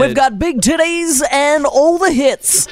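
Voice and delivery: laughing mock radio promo; hammed-up station slogan. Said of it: "sounds like a fucking radio station".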